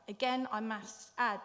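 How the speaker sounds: ordinary delivery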